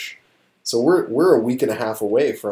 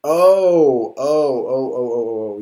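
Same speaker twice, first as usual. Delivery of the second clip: realization; 3 semitones higher, 6 dB louder, slower than usual. Real, not acted. Sudden realization of who it is.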